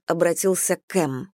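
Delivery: ordinary delivery, nothing marked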